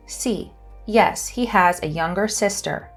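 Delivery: ordinary talk, nothing marked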